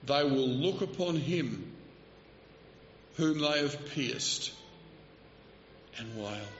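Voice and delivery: slow, solemn, fading to almost nothing; slow prophetic reading. Retold or imitated. Preacher quoting Zechariah slowly, fading out on wail. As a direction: read the prophecy slowly and let it die away. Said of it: "Zechariah the prophet says".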